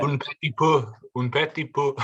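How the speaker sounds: mock French. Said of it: "joking French phrase".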